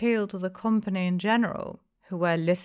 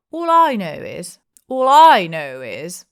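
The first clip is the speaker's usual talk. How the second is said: much louder, slowing bluster; loud assertion; 2 semitones higher, 16 dB louder than usual. A narrator's reading. Innkeeper bellowing his point to the tavern, loud. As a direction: shout it down over the room. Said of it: "loudly asserted mine host".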